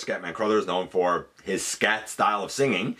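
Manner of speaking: normal talking voice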